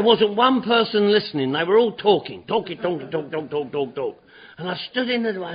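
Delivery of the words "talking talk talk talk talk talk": normal talking voice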